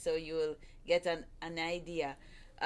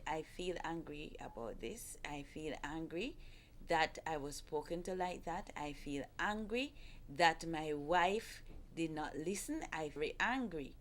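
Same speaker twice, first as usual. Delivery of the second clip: softer inner voice, admitting anger calmly; 4 semitones lower, 7 dB quieter than usual. Shown as a demonstration. Wife demonstrates a husband's calm inner admission of anger. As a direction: say it to yourself, quietly owning it. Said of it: "and saying, in your mind".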